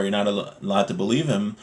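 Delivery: normal talking voice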